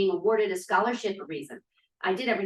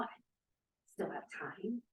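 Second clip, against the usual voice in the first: whisper; 2 semitones lower, 15 dB quieter than usual. Acted. Confessing doubt to herself.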